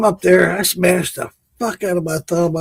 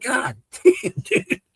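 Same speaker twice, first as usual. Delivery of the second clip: amazed exclaim; 9 semitones higher, 3 dB quieter, slower than usual. Retold or imitated. Amazed at roofer's nailing.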